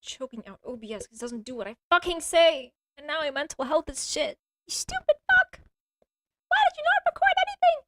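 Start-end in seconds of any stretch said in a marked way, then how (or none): 4.7-7.8 real, high, loud screaming rage; furious shout. Real, not acted. Yelling at OBS for failing to record, furious. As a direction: blow up at the software.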